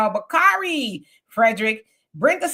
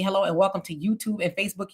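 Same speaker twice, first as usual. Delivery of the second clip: ordinary talk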